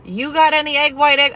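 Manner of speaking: loud, high call downstairs. Retold or imitated. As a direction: shout down to the kitchen. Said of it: "she calls down".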